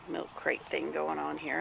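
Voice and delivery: ordinary delivery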